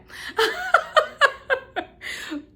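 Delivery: a wet laugh breaking out after near tears; teary laugh. Real, not acted. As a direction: laugh it off while still choked up.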